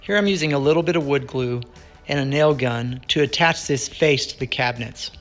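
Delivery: normal talking voice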